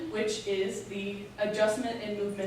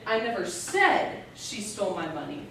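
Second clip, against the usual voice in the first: heavy emphasis on "said"; stress on said; 2 semitones lower than usual. Shown as a demonstration. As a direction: hit the word "said". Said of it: "You can stress each word in that sentence".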